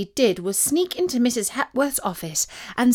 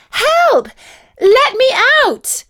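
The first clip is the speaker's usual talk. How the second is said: high, loud cry for help; panicked shout; 8 dB louder, slower than usual. A narrator's reading. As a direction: shout to be let out of a locked room. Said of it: "she shouted".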